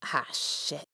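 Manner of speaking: breathless whispered curse; hushed stunned whisper. Acted. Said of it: "Grimmjow whispered".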